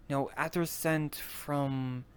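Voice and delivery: usual speaking voice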